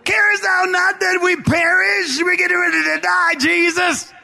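high, sustained shouted plea; desperate cry to be saved; panicked cry. Acted. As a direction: wail it out in hammed-up terror. Acts out panicked disciples.

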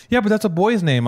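high, loud mimicked objection; objecting strangers. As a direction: imitate a woman pointing out the obvious. Mimics women objecting to name.

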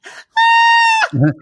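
piercing high squeal; loud high-pitched squeal; mock high squeal.

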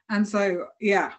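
usual speaking voice

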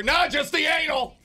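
high-pitched yelled answer; high shout. Pretend. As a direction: blurt it out in a squeal. Customer shouting at clerk.

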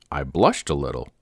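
plain reading voice

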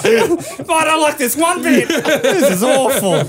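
distressed victim. Acted. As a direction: whimper it out like the pinned guy. Voicing the pinned guy.

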